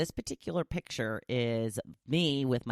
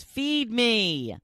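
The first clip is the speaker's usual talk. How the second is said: higher, demanding little voice; demanding pet; 10 semitones higher, 4 dB louder than usual. Pretend. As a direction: beg like a hungry cat. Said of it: "Laurie says".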